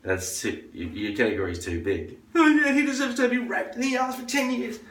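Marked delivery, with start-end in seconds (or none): 2.4-4.8 high, hammed-up mocking voice with a laugh. Comic mocks someone's opinion in a high, laughing put-on voice. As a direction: squeal it out, laughing at them.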